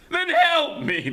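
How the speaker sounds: high urgent cry for help; high urgent plea. Acted. Prisoner begging for help.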